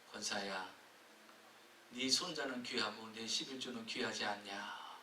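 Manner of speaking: low, soft, solemn divine voice; voice of God. Retold or imitated. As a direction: speak as God, gently rebuking. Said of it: "권사님 속에 음성이 들리더래요". (낮고 조용한 하나님의 음성)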